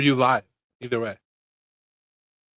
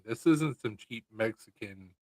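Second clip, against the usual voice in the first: ordinary talk, nothing marked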